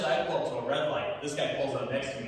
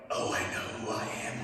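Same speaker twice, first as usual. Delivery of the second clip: realization; 4 dB quieter than usual. Retold or imitated. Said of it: "I'm like".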